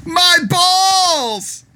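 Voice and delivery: high, shrill mock scream of pain; retold scream. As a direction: belt it out like a wrestler in agony. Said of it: "Bully Ray screaming".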